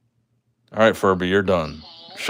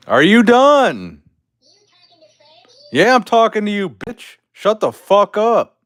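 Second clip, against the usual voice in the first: angry yelling; 8 semitones higher, 8 dB louder than usual. Real, not acted. Yelling at the talking Furby.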